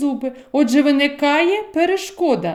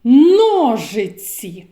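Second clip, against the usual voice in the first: slow, stretched word; 4 dB louder, much slower than usual. Shown as a demonstration. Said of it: "Повторіть".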